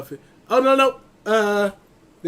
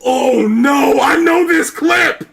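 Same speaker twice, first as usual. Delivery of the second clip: loud, dismayed cry of recognition; dreading shout; 6 semitones higher, 6 dB louder, slower than usual. Real, not acted. Friend shouts in dread as the clip starts. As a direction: groan it out, knowing what's coming.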